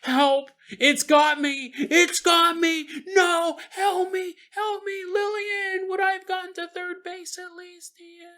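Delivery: high slow cries for help fading to faint; calling down from the sky, drifting away. Acted. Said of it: "His voice becomes more and more faint".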